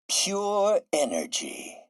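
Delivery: high, slow, drawn-out wonder; incredulous marvelling. Acted. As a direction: stretch it out, falling away in amazement. Marvels at Max's request.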